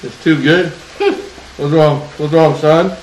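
loud playful teasing. Real, not acted. Dad teasing son eating spicy.

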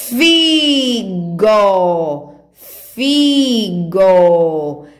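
FIGO with both syllables held long, FIII-GOOO; leitura silabada. Shown as a demonstration. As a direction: sound out FIGO slowly for children. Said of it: "Lembram da sílaba fi". (leitura esticada, sílaba por sílaba)

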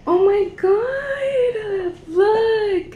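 high, long-drawn gasping wonder; amazed delight. Real, not acted. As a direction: draw it out high, in awe. Amazed by the room.

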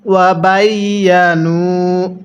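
ওয়া বাইয়ানু with ya doubled, slowly; careful tajweed; slow recitation. Shown as a demonstration. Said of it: "ইয়া জবর ইয়া".

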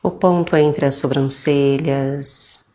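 slow, soothing meditation voice with drawn-out phrase endings; calm, slow guidance with long pauses; guided meditation. Acted. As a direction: guide them gently into relaxation, unhurried.

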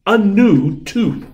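high, loud and slow with delight; thrilled. A narrator's reading. Tooth Fairy celebrating a new tooth, thrilled. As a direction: savour the news.

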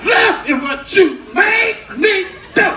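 high, loud, punched-out scolding; furious mother impression. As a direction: scold at full volume, word by word.

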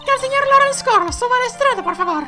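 very high put-on courtroom voice; haughty prosecutor. Prosecutor calls the next witness, high-pitched and formal.

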